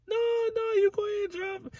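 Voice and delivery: high, soft imitation of mom; airy high-pitched mom brushing it off; mom's voice. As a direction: son mimics his mom declining to drive, high and soft. Mom voiced in retold story.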